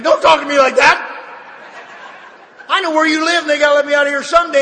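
high, loud retold outburst; angry retort. Patient silently answering back at his visitor, angry and loud.

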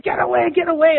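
high-pitched panicked repulse; imitated frantic cry. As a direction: act out pushing someone off in fright. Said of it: "screaming and yelling and she's saying".

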